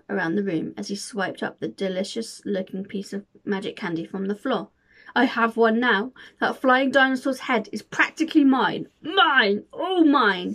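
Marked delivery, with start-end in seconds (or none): 5.1-10.5 louder triumphant crowing; gloating cackle. Acted. Hunter gloating over the candy, triumphant gloat. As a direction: gloat as if you've won. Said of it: "he cackled and puffed".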